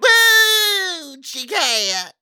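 shrieking yell. Acted. Hammed-up joking reply.

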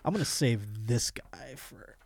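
whispered aside. Real, not acted. Host whispering to co-host that he'll skip this clip, whispered aside.

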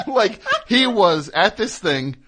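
shrieking laugh, then talking through it; high burst of laughter. Real, not acted. Laughing at the rude concertgoer, then talking through it. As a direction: laugh hard and keep going.

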